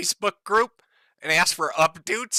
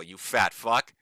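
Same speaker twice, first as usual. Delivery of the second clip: ordinary delivery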